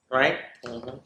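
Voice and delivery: usual speaking voice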